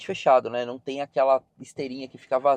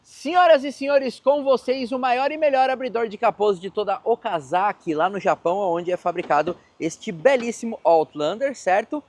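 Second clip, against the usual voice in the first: booming high showman announcer; grand entrance announcement; 7 semitones higher, 4 dB louder than usual. Pretend. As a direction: announce it like a circus ringmaster. Mock stage announcement of engine.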